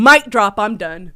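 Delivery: ordinary delivery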